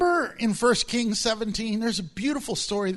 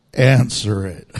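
very low, louder, booming "Answer it"; deep voice of the Lord; 12 semitones lower, 10 dB louder, slower than usual. Acted. The Lord insisting to a reluctant believer, booming low. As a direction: boom it out even deeper, like God insisting. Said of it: "And the Lord says".

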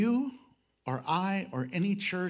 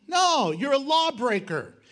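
ordinary talk

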